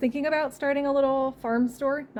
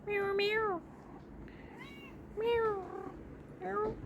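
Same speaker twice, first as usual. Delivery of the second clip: mock meow; 12 semitones higher, 10 dB quieter, much slower than usual. Meowing back to cat.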